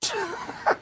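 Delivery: high-pitched soft laugh of amusement. Real, not acted. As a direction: laugh at his own impression. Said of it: "Can, can you imagine if that was my speech?".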